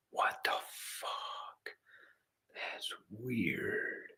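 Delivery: hushed unvoiced aside; whisper. Real, not acted. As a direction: drop to a whisper in disbelief.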